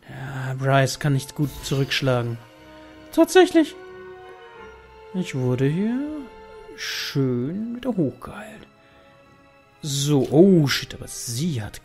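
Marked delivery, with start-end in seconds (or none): none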